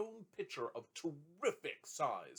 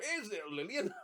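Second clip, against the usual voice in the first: laughing delight; 10 semitones higher, 5 dB louder than usual. A narrator's reading. Trotty greets child laughing.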